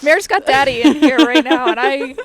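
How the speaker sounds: very loud, hammed-up impression; Mayer's "daddy" voice. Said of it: "does a funny Mayor impression".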